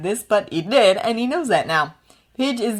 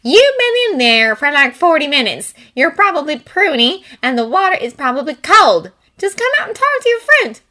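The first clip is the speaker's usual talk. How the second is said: exasperated sister; 9 semitones higher, 8 dB louder than usual. Acted. Sister nagging through door.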